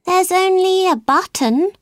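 put-on high, mildly sad girl; a touch of sadness, pitched high; sad reply. A narrator's reading. Alice answering the dodo about her pocket, mildly sad. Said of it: "she replied sadly".

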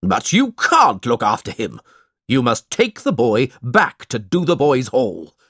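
insistent order in the uncle's voice; restrained angry shout. A narrator's reading. Uncle ordering the boy back to school, restrained shout. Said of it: "shouted Ralph".

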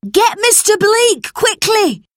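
man shouting for help after an accident; urgent shout. A narrator's reading. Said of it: "he shouted".

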